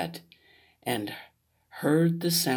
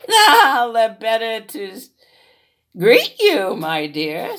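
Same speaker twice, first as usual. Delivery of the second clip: wolf as grandma; 6 semitones higher, 7 dB louder than usual. Acted.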